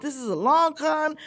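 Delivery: retold accusation, high and loud; upset. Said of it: "I'm upset because I'm like".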